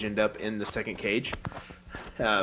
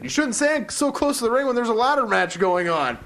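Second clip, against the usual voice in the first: high, louder prissy scolding voice; mock lecture; 11 semitones higher, 5 dB louder than usual. Wrestler scolding Sasha after the ladder hit, hammed-up. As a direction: wag your finger at her in a silly voice.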